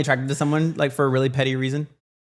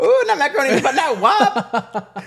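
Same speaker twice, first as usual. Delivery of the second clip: high, laughing, louder; laughing correction; 13 semitones higher, 4 dB louder, slower than usual. Real, not acted. Teasing her cohost's odd phrase, laughing high. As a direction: giggle through the teasing correction.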